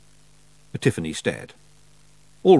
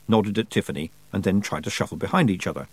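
usual speaking voice

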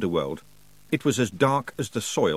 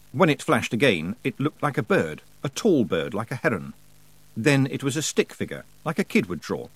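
usual speaking voice

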